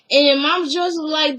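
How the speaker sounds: ordinary delivery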